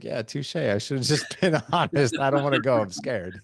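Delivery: high, laughing admission of fear; laughing confession. Retold or imitated. Telling the father-in-law he is scared, laughing. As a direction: giggle through the confession, sheepish. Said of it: "I was like".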